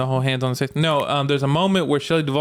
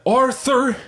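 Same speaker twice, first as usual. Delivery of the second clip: Dutch van der Linde voice; 11 semitones higher than usual. Retold or imitated. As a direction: say it like Dutch calling Arthur. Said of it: "how does he say it?".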